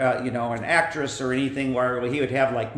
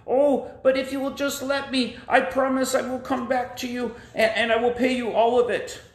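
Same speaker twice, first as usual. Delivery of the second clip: pleading friend; 9 semitones higher than usual. Acted. Friend promises to repay.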